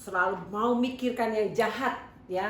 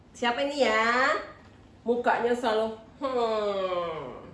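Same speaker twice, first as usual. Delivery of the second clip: normal talking voice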